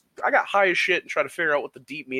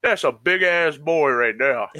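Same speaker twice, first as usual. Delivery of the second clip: imitating homeless man; 5 dB louder, slower than usual. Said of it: "this homeless dude goes".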